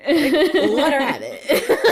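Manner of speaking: slow, loud laughing line. Real, not acted. Echoing the friend's line while laughing. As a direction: laugh through it.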